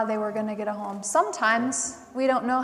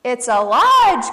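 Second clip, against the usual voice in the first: a slow, loud, proud muskrat; 3 semitones higher, 13 dB louder, slower than usual. A narrator's reading. Muskrat offering his lodge to the possums, loud and proud.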